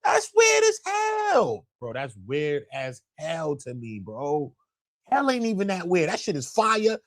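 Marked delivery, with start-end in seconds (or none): 0.0-1.7 much higher pitched, slow exclaim; outburst. Real, not acted. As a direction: cry out in disbelief, drawn out.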